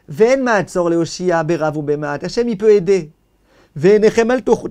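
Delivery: loud, declaiming reading of the sacred text with translation; fervent text reading. Acted. Teacher reading the heavenly voice's message to students, declaiming. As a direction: proclaim the heavenly voice's words to the class.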